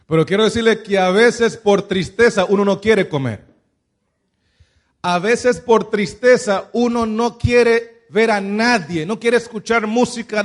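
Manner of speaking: ordinary delivery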